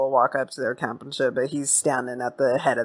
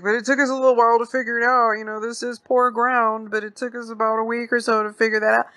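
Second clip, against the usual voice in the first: put-on higher-pitched, agreeable old man; old dude; 7 semitones higher, faster than usual. Old dude smoothing things over with the stranger, high old-man voice. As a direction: play the old man placating in a raised voice. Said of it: "the old dude jumps in".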